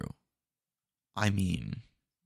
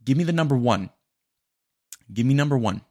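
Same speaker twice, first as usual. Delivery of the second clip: loud fake order; 4 semitones higher, 5 dB louder than usual. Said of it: "reverse psychology".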